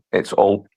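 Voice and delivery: "Hull" with no H, starting on the vowel; Yorkshire "Hull" without its H; dropped H. Shown as a demonstration. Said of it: "stop pronouncing Hull with the, uh, H".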